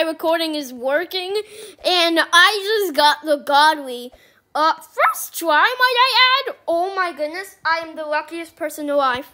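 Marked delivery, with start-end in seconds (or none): none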